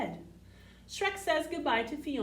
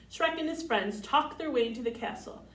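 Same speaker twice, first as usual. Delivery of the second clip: ordinary delivery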